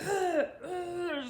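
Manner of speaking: laughing through mock retching; hammed-up retching; retching groans. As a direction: gag it out while laughing. Retelling throwing up.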